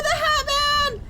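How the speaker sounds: high, loud half-laughing terror; panicked screaming. Real, not acted. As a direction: shriek it while laughing and crying at once. Chased by monster, screaming.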